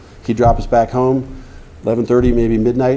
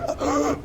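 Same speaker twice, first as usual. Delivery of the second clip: retold gasp; 10 dB quieter than usual. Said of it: "I was just like".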